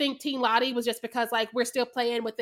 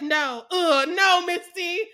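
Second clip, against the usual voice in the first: higher, louder dying protest; mock protest; 6 semitones higher, 5 dB louder, much slower than usual. Retelling character stopping Misty, raised mock protest. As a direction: imitate her objecting in dismay. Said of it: "She's like".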